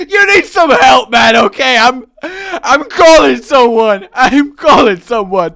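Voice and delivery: high, loud mock panic; alarmed shouting. Friend calling for help for addict, hammed-up shouting.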